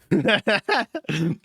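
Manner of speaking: ordinary delivery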